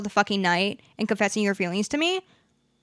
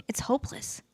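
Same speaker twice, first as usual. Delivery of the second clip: whispered despair; 4 semitones higher, 9 dB quieter than usual. Real, not acted. Despairing about crushes.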